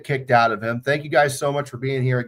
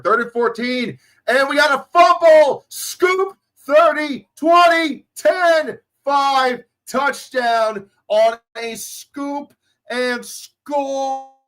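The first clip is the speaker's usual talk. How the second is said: high, loud touchdown call, drawn out at the end; excited play-by-play; 12 semitones higher, 4 dB louder, much slower than usual. Real, not acted. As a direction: call the scoop-and-score live, building to a stretched cry. Live play-by-play.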